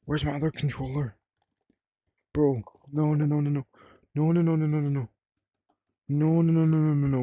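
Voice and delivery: fretting over something mislaid; mild panic. Real, not acted. Hunting for the other controller, mildly panicked. Searching for his lost controller.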